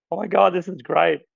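real thrilled reaction; excited. Real, not acted. Presenter has never heard of that, excited. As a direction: let the discovery delight you. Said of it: "I'm getting excited".

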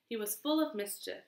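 ordinary delivery

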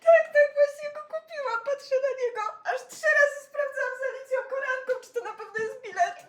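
high fast teary laughter; giggle, nearly sobbing; laughing through tears. Real, not acted. Overjoyed, laughing and crying. (śmiech przez łzy)

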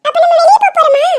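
put-on high, small child's voice asking eagerly. Little daughter asks dad about going out, high child voice. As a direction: sound like a little girl.

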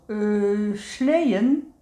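drawn-out, questioning "sleeën" on waking; half-awake, hesitant query; sleepy mumble. A narrator's reading. Woezel opens one sleepy eye at the mention of sledding, sleepy mumble. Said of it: "mompelt hij".

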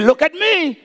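a father calling his son's eyes to him; urgent command. Retold or imitated. Father calling his panicking son.

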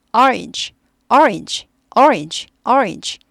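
orange repeated slowly and clearly, stress on the first syllable; model pronunciation drill. Shown as a demonstration. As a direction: say orange with a strong first beat. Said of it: "따닥 따라하시고 곱하기 여섯 번".

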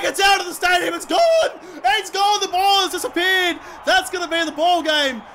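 high, excited commentator roar; exultant goal celebration; triumphant shouting. Real, not acted. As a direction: holler as the winning kick sails away. Celebrating match-winning goal.

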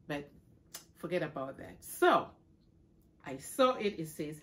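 ordinary delivery

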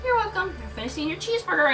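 high aunt voice; high-pitched motherly aunt; Aunt May. A narrator's reading. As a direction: start high, then hurry the instruction. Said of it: "Aunt May said".

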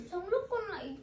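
usual speaking voice

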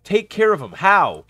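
loud, high frustrated questioning; exasperated. Real, not acted. Player stuck with the gnome, loudly exasperated. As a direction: throw the instruction back loudly, fed up. Frustrated at unclear game task.

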